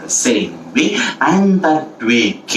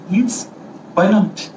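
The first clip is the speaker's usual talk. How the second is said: higher, small-creature reply; a hint of tiny ant voice; lightly voiced ants; 7 semitones higher, slower than usual. Ants accept the elephant's invitation, lightly voiced high reply.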